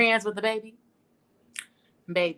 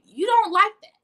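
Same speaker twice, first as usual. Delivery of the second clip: emphatic exclamation; 11 semitones higher, 10 dB louder than usual. Real, not acted. Emphatic point.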